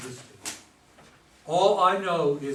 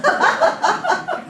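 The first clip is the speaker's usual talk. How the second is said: loud laugh; 10 dB louder than usual. Acted. [name] laughs at Joe's confusion.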